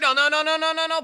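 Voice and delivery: high, loud rapid pleading; panicked no no no. Real, not acted. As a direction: beg the play not to happen. Runner about to be out.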